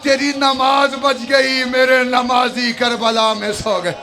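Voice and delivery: high, slow wailing lament; a granddaughter wails at the grave; weeping lament. Acted. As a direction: wail the loss to the grandfather. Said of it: "बीबी रो के कहती".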